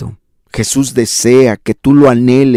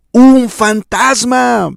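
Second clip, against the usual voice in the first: high, slow, drawn-out scream of fear; terrified cry; 12 semitones higher, much slower than usual. Acted. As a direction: cry out as if seeing a ghost. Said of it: "dieron voces de miedo".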